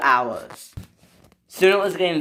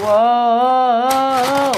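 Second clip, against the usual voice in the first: drawn-out high, loud whoa; rising holler while being flung; long alarmed whoa; 8 semitones higher, 5 dB louder than usual. Acted. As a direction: wail it as you fly off. Engine flung into the air.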